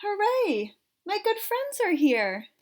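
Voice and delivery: high, delighted cheer; excited. Acted. Amos delighted to see friends.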